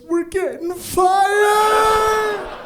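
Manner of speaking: high, loud, stretched-out panic yell; panicked yell. Retold or imitated. Coworker panicking at the boss's arrival, loud yell. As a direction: shout it like doom is here. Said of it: "he goes".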